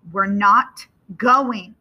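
slow, forceful refusal; loud and final; very loud father. A narrator's reading. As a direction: lay down the law loudly, word by word. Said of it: "his voice is very loud".

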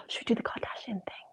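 hushed plotting whisper. Real, not acted. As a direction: whisper the idea. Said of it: "plotting a prank while the guest is away".

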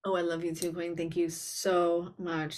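usual speaking voice

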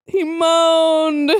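high, drawn-out, hammed-up moaning; mock moan. A narrator's reading. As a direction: moan the narration itself, stretching MOANED. Repeats the tag as a moan.

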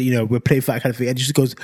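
normal talking voice

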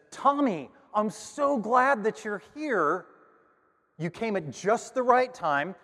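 high, sing-song teacher greeting, slowed right down. Retold or imitated. Teacher welcoming Tommy to class, high and syrupy.

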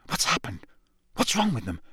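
hushed whispered question. Acted. Onlookers whispering to each other, hushed. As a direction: whisper from the crowd.

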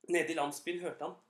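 plain reading voice

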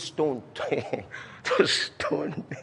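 breaking into laughter while reading; soft, voiceless words through a laugh. Real, not acted. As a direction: try to read on while cracking up.

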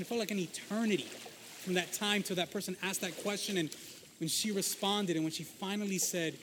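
ordinary delivery

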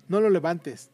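loud, cold command; harsh order. A narrator's reading. Montiel orders his wife not to lift the boy, harsh. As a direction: forbid her sharply. Father forbids comforting child.

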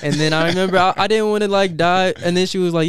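high, loud, giddy retelling; excited. Real, not acted. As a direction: bubble over with excitement.

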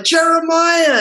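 high, drawn-out, delighted call; really friendly greeting. Retold or imitated. Producers greeting actor after years apart, really friendly. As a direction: hail an old colleague with excitement. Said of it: "they're really friendly".

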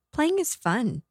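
put-on high, childlike voice; lightly put-on small boy's lilt; little boy. A narrator's reading. Ghost boy coaxes the man, high and childlike.

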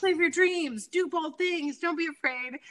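high, bright mock pep-talk voice; mock self-help voice. Guest mocking the self-help book her husband bought. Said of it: "And it was like".

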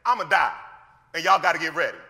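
loud, blunt paraphrase of a warning; plain-spoken and emphatic, no softening; straight up. Retold or imitated. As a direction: give it to them straight, with force. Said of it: "Jesus was straight up".

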